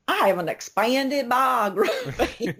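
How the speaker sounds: slow, high-pitched Southern drawl, mocking yourself; hammed-up Louisiana twang, close to laughing; exaggerated Southern accent. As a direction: send up your own Southern accent, stretching the vowels. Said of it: "hear myself say ... that sounds terrible".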